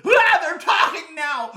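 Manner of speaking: very high, loud, drawn-out shriek; panicked shriek. Acted. Man hears red button talking, panicked shriek. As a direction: shriek in comic terror.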